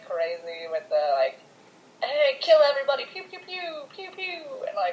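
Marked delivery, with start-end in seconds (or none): 2.0-3.0 louder, faster mock rallying cry; hammed-up gung-ho hero; mock battle cry. Mocking trigger-happy Batman. 3.1-4.4 high soft pew pew blaster noises; play-gun sound effects like a child; mock gun sounds. Imitating gunshots.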